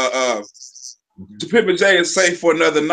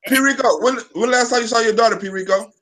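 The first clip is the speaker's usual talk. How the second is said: calling out; 4 semitones higher than usual. Real, not acted. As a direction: call his name out, raised. Calling out Rico on stream.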